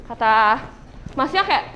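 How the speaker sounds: ordinary talk, nothing marked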